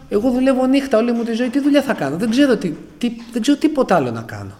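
very high, loud desperate outcry; desperate fear. Retold or imitated. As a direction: cry out that you know nothing else. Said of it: "Μετά έλεγα".

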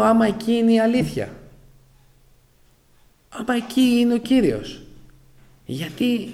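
high, loud pleading cry to God; anguished inner questioning. Retold or imitated. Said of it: "Λέω".

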